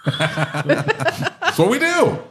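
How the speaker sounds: high, drawn-out words through laughter; laughing admission. Real, not acted. Shop owner owns his sales routine, laughing. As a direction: crack up while owning up to it.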